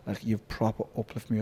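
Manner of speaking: soft and quick, heartfelt; quiet retold Brendan. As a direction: murmur it like a grateful friend. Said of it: "He was like".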